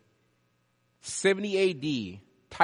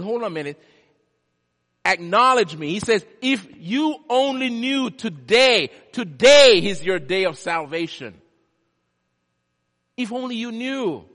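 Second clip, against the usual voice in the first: Jesus pleads with the city, loud and rising; Jesus pleading; 6 semitones higher, 12 dB louder than usual. Acted. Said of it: "He said".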